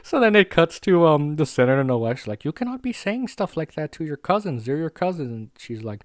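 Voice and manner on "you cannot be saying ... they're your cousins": higher-pitched mock parental reprimand; retold scolding. Senator's wife telling off a child, mock scolding.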